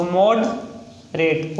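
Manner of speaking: Moderate slowly split into "mod... rate"; sounding out. Shown as a demonstration. Teacher breaking an example word down for learners, slow syllables. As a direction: say moderate in two drawn-out parts with a gap.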